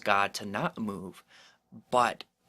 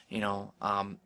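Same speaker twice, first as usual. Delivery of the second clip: ordinary talk, nothing marked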